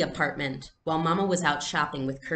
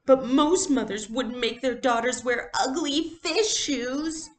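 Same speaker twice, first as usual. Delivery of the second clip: put-on higher, louder childish whine; whiny complaint; 9 semitones higher, 4 dB louder than usual. Little sister protesting to her friend, whiny.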